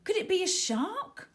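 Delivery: rising panic, ending in a loud high shout; terrified girl spots a shark fin and yells; frightened cry for help. A narrator's reading. Girl alone in the sea sees sharks, panicked cry. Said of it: "Tara gasped".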